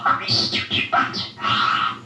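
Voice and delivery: normal talking voice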